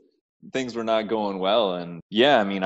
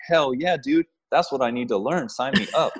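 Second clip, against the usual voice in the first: eager excitement; 6 semitones higher than usual. Retold or imitated. Retold eager sign-up reaction.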